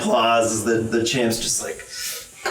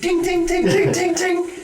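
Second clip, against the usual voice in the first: very high squeaky ting; high clinking noise. Acted. Baby Skarmory following you, high squeaky tings. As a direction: chirp the tings like tiny metal.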